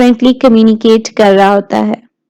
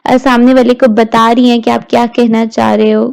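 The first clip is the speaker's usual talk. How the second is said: ordinary talk, nothing marked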